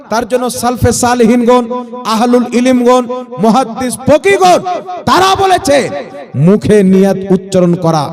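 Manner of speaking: loud, driving sermon delivery; pounding out each phrase like a fiery waz; preaching at full force. Acted. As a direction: preach it hard to the gathering.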